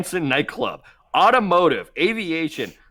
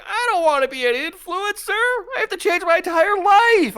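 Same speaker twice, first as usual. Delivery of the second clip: высокое нытьё won't do; 4 dB louder than usual. Acted. Acts out himself panicking at being influencer.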